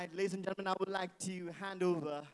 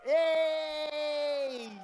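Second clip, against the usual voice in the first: loud, higher cheer; cheering yay; 9 semitones higher, 11 dB louder than usual. Real, not acted. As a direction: whoop for the guest. MC cheering a named guest.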